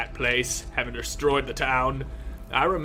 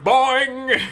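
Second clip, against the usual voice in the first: high, loud boing sound; cartoon boing; 12 semitones higher, 8 dB louder than usual. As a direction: bounce the word like a spring. Imitates body bouncing.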